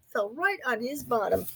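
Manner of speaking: ordinary delivery, nothing marked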